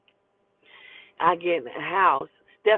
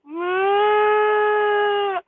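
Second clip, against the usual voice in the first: long, high, loud wail; frightened wail; 12 semitones higher, 5 dB louder than usual. Retold or imitated. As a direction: hold a high mock-terrified cry. Her frightened wail to the driver.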